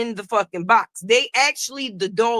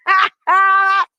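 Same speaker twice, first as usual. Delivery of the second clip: loud, high burst of laughter; real loud cackle; laughing; 7 semitones higher, 7 dB louder than usual. Real, not acted. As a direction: crack up at your own accent. Cracks up at own accent.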